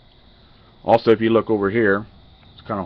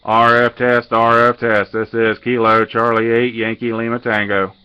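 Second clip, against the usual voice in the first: louder, deliberate on-air call sign read; radio test call; 5 dB louder, slower than usual. Acted. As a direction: announce the call sign clearly over the air.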